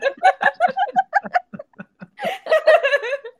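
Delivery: long high laughing fit; high laughter. Real, not acted. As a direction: crack up laughing.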